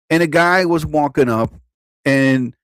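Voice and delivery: usual speaking voice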